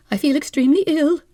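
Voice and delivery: high, plaintive invalid voice; a nervous hypochondriac wife, complaining; fretful wife. Acted. Wife feigns illness.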